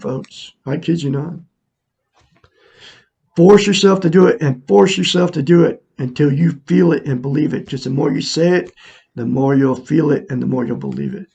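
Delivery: normal talking voice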